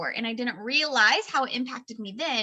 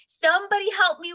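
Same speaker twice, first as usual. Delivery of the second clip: higher mock-desperate plea; retold plea for help; 7 semitones higher, 4 dB louder than usual. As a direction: plead for help, half in jest.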